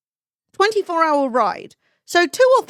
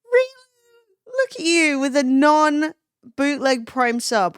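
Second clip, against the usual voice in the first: hammed-up tearful thanks; mock crying; 3 semitones higher, slower than usual. Streamer thanking a viewer, mock crying.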